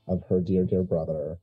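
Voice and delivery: usual speaking voice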